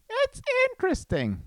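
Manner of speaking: high sing-song "interesting"; silly high voice. Acted. Musing to viewers in a silly high voice. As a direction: toss it up high and playful.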